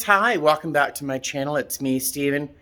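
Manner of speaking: ordinary talk, nothing marked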